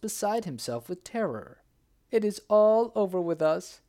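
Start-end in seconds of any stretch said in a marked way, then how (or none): 2.1-3.8 loud, high despairing wail; a narrated wail of despair. A narrator's reading. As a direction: lament that all is lost. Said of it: "he wailed".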